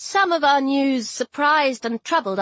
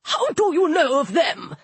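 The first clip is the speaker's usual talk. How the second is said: high, astonished question; aghast god's startled query; gasped; 5 semitones higher than usual. A narrator's reading. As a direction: demand it, taken aback. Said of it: "Disturber gasped".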